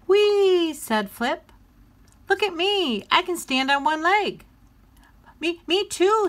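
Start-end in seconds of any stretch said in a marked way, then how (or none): 0.1-0.7 high, loud gleeful whee; a child squealing with joy; excited squeal. Acted. Little penguin squealing at play, gleeful. 2.3-4.4 put-on high, loud little-kid boast; proud kid showing off; showing off child. Flip boasting to his brother, high and loud. 5.4-6.3 high, loud eager splutter; a toddler rushing to keep up; spluttering little brother. Acted. Flop copying his big brother, eager splutter. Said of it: "spluttered Flop".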